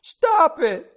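pleading, outraged high cry; child's distressed shouting. A narrator's reading. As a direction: act out a little girl protesting desperately. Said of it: "she kept shouting".

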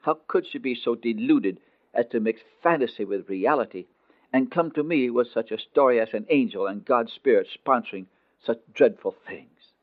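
usual speaking voice

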